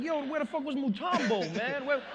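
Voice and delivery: hammed-up loud host yelling; mock host. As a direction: shout it like a baffled MC. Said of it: "he's like".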